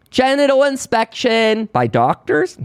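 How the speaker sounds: high, rising incredulous question; mock incredulous. Acting out the accusers' reply.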